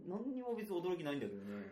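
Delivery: normal talking voice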